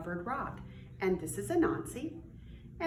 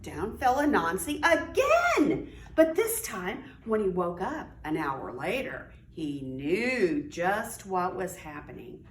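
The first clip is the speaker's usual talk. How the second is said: lively storybook read-aloud; reading to children; 6 dB louder than usual. A narrator's reading. Teacher reading aloud to young children, animated storytelling. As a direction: read it like story time for little kids.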